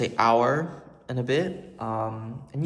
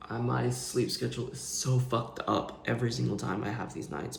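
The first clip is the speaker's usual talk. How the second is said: ordinary talk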